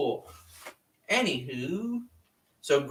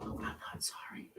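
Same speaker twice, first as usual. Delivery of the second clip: breathy whisper, much softer; 19 dB quieter than usual. Real, not acted. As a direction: whisper it as a cheeky aside. Joking retraction of apology.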